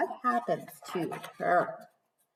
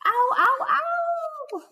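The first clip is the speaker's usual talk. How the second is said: much higher, loud ows; big painful ows; 3 dB louder, much slower than usual. Acted. Wolf with his nose slammed in the door, loud yelps. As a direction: yelp each ow. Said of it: "how big those words are".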